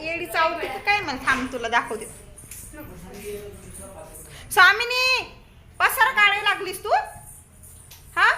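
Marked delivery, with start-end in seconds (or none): 4.5-8.3 mock scolding. Real, not acted. Scolding baby for mess.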